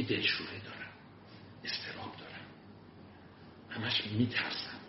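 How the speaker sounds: whispered, nervous confession, slowing at the end; anxious whisper. Retold or imitated. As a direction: breathe out the worry, hushed and tense. Anxious student before a talk. (زمزمهٔ مضطرب و آهسته)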